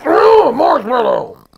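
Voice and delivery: high, loud wail into a shout; anguished scream. Retold or imitated. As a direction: scream the safe word in desperation. Screaming safe word, held by bear.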